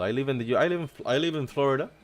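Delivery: usual speaking voice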